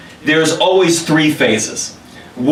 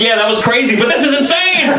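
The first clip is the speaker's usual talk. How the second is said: high, panicked yelp; mock screaming; 9 semitones higher than usual. Soldiers freaking out in the van, mock-hysterical. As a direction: pitch climbing into comic hysteria. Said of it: "hears these four men just screaming".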